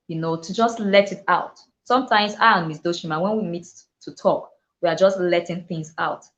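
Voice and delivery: ordinary talk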